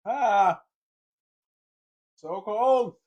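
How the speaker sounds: loud, high yelp from the cold; cold shock yelp. Real, not acted. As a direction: cry out at the sudden cold.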